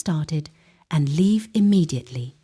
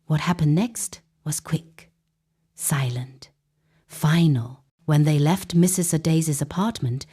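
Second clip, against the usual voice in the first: ordinary delivery, nothing marked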